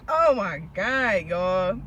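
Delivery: ordinary talk, nothing marked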